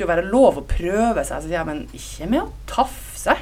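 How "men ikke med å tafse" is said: 1 slow lecturing. Retold or imitated. Lecturing the guy at the bar.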